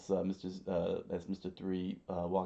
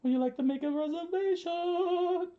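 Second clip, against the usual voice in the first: very high, loud falsetto phone greeting; sing-out falsetto receptionist; Sanji; 10 dB louder than usual. Acted. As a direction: answer in an exaggerated squeaky falsetto. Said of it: "remembered hearing Sanji's voice".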